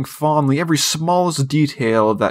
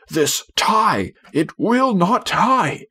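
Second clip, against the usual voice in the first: exasperated father; 8 semitones higher, much slower than usual. A narrator's reading. Father frustrated with tie.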